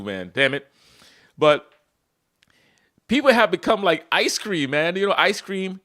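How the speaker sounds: ordinary talk, nothing marked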